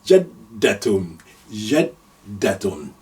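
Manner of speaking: "Jaddatun" drawn out very slowly, doubled d; slow careful "Jaddatun"; slow demo. Shown as a demonstration. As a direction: spell out "Jaddatun" slowly for learners. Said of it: "you say jaddatun".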